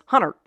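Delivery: "Hunter" for hundred, as in Pittsburgh; Pittsburgh pronunciation. Shown as a demonstration. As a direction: say "Hunter" with the middle d of hundred dropped. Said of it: "say with a Pittsburgh accent was".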